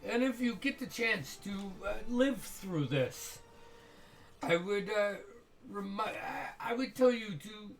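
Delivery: sarcastic menace with a false friend; dripping with venom. Acted. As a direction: purr threats politely. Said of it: "Dripping with venom".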